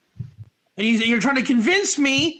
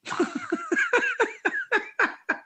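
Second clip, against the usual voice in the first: a sudden high burst of laughter; 5 dB quieter than usual. Real, not acted. Teacher laughs at his own example, high laughing. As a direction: laugh out at the obvious.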